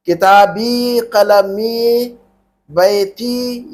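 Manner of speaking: very slow, each word drawn out; slow careful repeat. Shown as a demonstration. Teacher repeating the examples slowly for the student. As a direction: stretch every word so the ending is heard. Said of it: "दोबारा सुनो मैंने क्या कहा".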